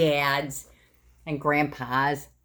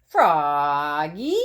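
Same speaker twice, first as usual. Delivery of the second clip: dad yelling, louder; 2 semitones lower, 5 dB louder than usual. Acted. Scene: Dad yells at Froggy over the noise, louder. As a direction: yell up from downstairs. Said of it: "yelled Dad".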